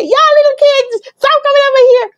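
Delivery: put-on high, playful old neighbor shooing kids; imitating Mister Brown. Neighbor shouting at kids stealing his oranges, mock stern. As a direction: call out like a fake-stern neighbor. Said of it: "Mister Brown would come out and say".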